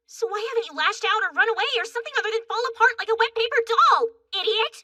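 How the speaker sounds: angry goading. Acted. Goading someone to get mad, angry. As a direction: rush it out, then drag the insult. Angrily goads the other.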